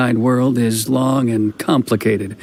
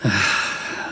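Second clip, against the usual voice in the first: short unvoiced sigh of resignation; a quiet resigned exhale; 4 semitones lower, 8 dB quieter than usual. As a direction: sigh as if giving in. Alone after letting boat go.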